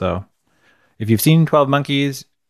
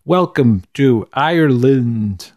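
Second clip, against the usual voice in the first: slow, stilted mock Irish accent; Christopher Walken's Irish accent; much slower than usual. Cohost mimics the film's narrator, slow halting Irish accent. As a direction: drag it out in a bad Irish brogue. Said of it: "That was like an impression".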